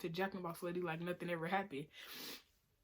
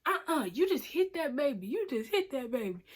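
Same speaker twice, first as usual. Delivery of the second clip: imitated yelling; 5 semitones higher, 4 dB louder than usual. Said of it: "she started yelling at the Indian lady".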